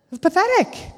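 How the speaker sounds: high scornful sneer; scornful aside. Retold or imitated. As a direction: spit out the contempt in a high voice. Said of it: "in this camp going".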